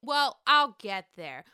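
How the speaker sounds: ordinary talk, nothing marked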